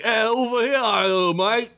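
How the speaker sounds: lightly put-on British mate voice; a hint of a cheeky bloke accent; mock accent. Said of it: "He's like".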